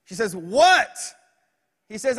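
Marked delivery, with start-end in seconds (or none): 0.3-1.0 loud, raised "What?"; exasperated mom. Acted. Mom answers her boy's bedtime call, exasperated and loud. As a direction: answer like a fed-up mother.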